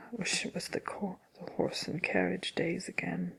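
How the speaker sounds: very soft, breath-heavy muttering; hushed aside after a sigh; wistful grumble. Acted. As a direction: grumble it to yourself, nearly whispered.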